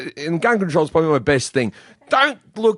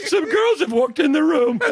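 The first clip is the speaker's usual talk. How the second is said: high, squeaky teasing impression; mock a flustered lad in a very high voice; voice up octaves; 12 semitones higher than usual. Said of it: "Your voice just went up three octaves".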